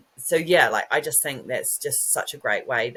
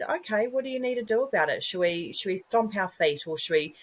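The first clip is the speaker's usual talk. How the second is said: higher, fast, sing-song encouraging mum voice; bright mum to toddler; 4 semitones higher than usual. Retold or imitated. Mum coaching her frustrated toddler, higher and fast. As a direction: coax a little one into moving. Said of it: "I said".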